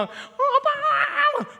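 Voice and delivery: put-on very high, drawn-out toddler squeal; happy baby voice. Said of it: "되게 좋아하고 막 안기고". (아주 높고 길게 아기 목소리)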